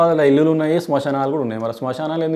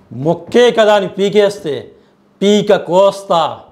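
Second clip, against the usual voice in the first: slow, menacing hero threat, higher and louder; Chiranjeevi dialogue; 4 semitones higher, 4 dB louder, much slower than usual. Retold or imitated. Guest recites a Chiranjeevi dialogue from Indra, slow and threatening. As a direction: drag it out like a film hero's warning. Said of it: "Chiranjeevi డైలాగా?".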